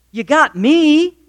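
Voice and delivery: high, slow, drawn-out mock reassurance; self-satisfied husband. Husband consoling his crying wife, high mock reassurance. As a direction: offer yourself as the grand answer, hammed-up. Husband offers himself as comfort.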